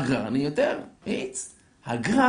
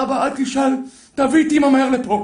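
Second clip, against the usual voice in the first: high, loud punchline, building; acted eager order to his son; urgent excitement; 9 semitones higher, 7 dB louder than usual. Father urgently delivers the joke's punchline. (התלהבות דחופה בקול גבוה)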